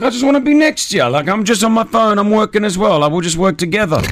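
retold eager, high-pitched protest; pleading self-quote. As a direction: rattle it off high, making your case. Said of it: "I'm like".

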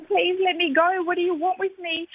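high, pleading plea through tears, only partly sobbing; retold crying plea, mildly tearful. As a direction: beg to be let go, voice catching. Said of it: "And I'm crying".

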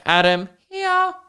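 put-on high, slow child's "here"; kid answering roll call. Pupil answering the teacher's roll call, high kid voice. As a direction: chirp it like a small pupil.

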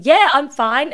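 mock cheerful reassurance, pushing through; brave polite front. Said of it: "instead of going".

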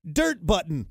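slowed, announcing the word of the day; radio segment announcer. Acted. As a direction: read it like a show host introducing a segment.

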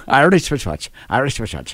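tongue twister in a light mock-Irish accent; attempted Irish accent. Shown as a demonstration. Host demonstrating the tongue twister for co-hosts, Irish accent. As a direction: lightly put on an Irish brogue. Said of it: "uh, Irish accent".

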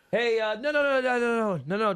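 high run of mock-polite no's; racketeer objecting. Racketeer stops the newcomer from leaving, high insistent objection. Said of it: "And then he goes".

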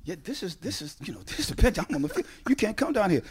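high, hushed protest, building; hushed pleading. Acted. Player pleads with his mother to leave the bench, hushed and urgent. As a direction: plead under your breath, pitch climbing.

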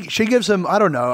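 normal talking voice

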